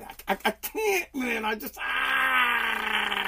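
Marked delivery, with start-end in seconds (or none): 1.4-3.2 frustrated groan. Real, not acted. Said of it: "drives me nuts".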